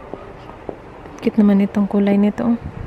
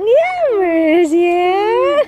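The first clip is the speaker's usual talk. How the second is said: high, very slow sing-song coo; 7 semitones higher, 3 dB louder, much slower than usual. Real, not acted. Mother cooing to her baby boy, high slow coo.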